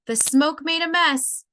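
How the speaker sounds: a mild hammed-up fire chief shout. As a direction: call it out the door to the family. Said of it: "called out the door with a shout".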